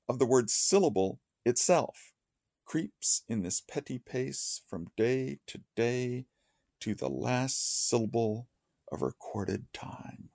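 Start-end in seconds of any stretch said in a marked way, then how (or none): 2.6-10.3 slow, softer verse with drawn-out beats; slow heavy stresses. Shown as a demonstration. Lecturer showing how the line slows with heavy stresses, slow measured recital. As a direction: dwell on each stressed word, fading softly at the end. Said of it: "The line slows with those heavy stresses".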